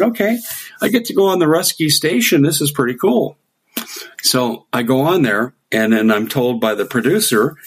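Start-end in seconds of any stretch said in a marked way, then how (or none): 0.0-3.4 higher, eager inner voice; pleased at landing the Russian show, eager; excited. Retold or imitated. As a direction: think it with giddy delight.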